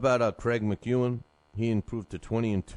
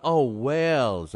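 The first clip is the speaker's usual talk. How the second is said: sudden realization; 5 semitones higher, 6 dB louder, much slower than usual. Real, not acted. Realizes Welsh means from Wales.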